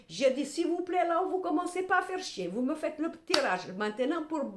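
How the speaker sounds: reenacting her irritated plea to the tarot; exasperated outburst. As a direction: snap at the cards, out of patience. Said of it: "Je me bafouille tellement je suis énervée".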